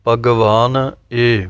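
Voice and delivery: ਭਗਵਾਨ ਇਹ said slowly as two distinct words; separated words. Shown as a demonstration. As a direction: pause clearly between ਭਗਵਾਨ and ਇਹ. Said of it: "ਇਹ ਪਾਠ ਵੱਖਰਾ ਕਰਨਾ ਹੈ".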